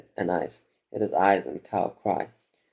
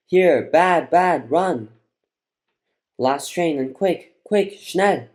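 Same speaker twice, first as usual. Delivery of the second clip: high, loud, urgent yell; yelled warning; 7 semitones higher, 7 dB louder, much slower than usual. A narrator's reading. Grandma yelling at the family to run for the last train, urgent yell. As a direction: shout in broken bursts to make them flee. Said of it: "And Grandma yelled".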